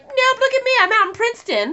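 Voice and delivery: very high, loud mimicry; hammed-up, high-pitched parody of flouting the rules; mocking taunt. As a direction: gloat in a high mock voice. Mocks doctor flouting her quarantine.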